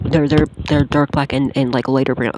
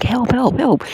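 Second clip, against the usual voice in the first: high, panicked call for help; mock cry for help; 9 semitones higher, slower than usual. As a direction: yelp it out like you're trapped. Calling for help in dream.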